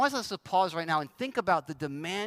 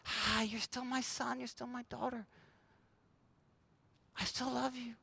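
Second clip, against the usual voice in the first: tender God voice; 3 semitones higher, 11 dB quieter than usual. Acted. God answering the anxious believer, very soft and tender. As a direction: answer like a gentle father.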